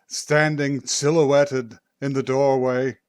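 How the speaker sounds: dramatic summoning sorceress; grand spooky narration. Acted. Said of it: "I am Magpie".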